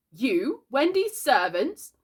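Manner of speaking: slow, rising disbelief; high, loud, appalled query; incredulous. A narrator's reading. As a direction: draw out the scandalised question. Aghast brother questions the boys.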